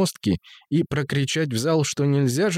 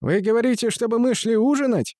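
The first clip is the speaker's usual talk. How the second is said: high-pitched voice; 10 semitones higher than usual. Acted. Deaf musician, voice raised.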